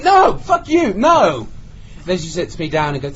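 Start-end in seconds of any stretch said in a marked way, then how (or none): 0.0-1.5 high, loud indignant outburst; outraged mock refusal. Offered another pricey room, outraged mock refusal. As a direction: throw the offer back loudly.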